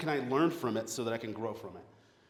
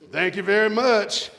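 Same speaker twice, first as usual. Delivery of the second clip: donkey's thanks; 6 semitones higher, 8 dB louder than usual. Acted. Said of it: "That donkey said".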